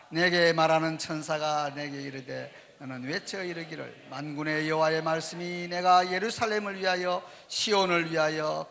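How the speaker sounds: measured, low-pitched reading aloud; scripture reading. Real, not acted. Leads congregational scripture reading.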